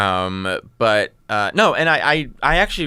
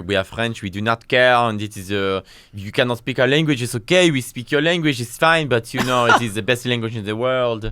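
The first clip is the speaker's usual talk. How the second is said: lightly voiced smug French local, a bit louder; a hint of a haughty Frenchman; 2 semitones higher, 4 dB louder than usual. Mocking how French people answer foreigners, a light haughty French voice.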